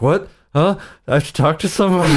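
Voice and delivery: incredulous retold reaction; mock disbelief. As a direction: balk at the suggestion in playful dismay. Acts reaction to being introduced.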